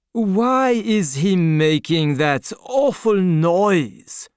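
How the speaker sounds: a proud nobleman, unmoved by grief; haughty Marquis. A narrator's reading. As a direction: look down your nose at the crowd, drawn out. Cold aristocrat voiced in story.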